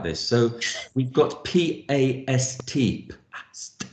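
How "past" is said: "past" in separate, very soft letter sounds; sounded out. Shown as a demonstration. Teacher posing a pronunciation question, "past" segmented softly.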